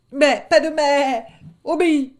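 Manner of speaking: high, loud enraged command; loud angry wolf order; furious order. Acted. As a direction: cut him off in rage. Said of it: "Le ton était si plein de rage".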